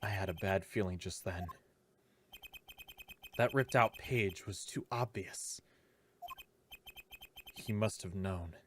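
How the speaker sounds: hushed narrator reading; quiet inner narration. Acted. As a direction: read the thoughts softly to yourself.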